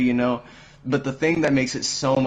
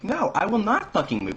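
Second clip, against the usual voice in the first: much higher-pitched imitation of a refusing customer; mock stubborn refusal; 13 semitones higher than usual. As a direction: dig in like an outraged customer, retold. Customer refuses to move, retold.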